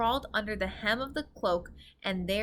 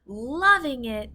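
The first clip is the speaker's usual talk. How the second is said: loud, slow giant yell; 4 semitones higher, 7 dB louder, slower than usual. A narrator's reading. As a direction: yell it back in disgust. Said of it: "yelled the blood bottler".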